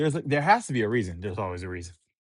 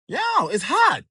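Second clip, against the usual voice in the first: hot wok yelp; 13 semitones higher, 5 dB louder than usual. Acted. Luffy touches hot wok.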